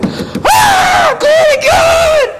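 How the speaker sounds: drawn-out, very high praise shout; ecstatic scream. Retold or imitated. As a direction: scream praise as if it bursts out of you. Acting out people bursting with joy.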